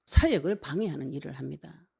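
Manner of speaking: ordinary delivery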